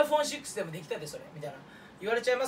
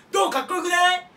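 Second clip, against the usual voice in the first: excited; 9 semitones higher, 9 dB louder than usual. Real, not acted. Showing off his answer.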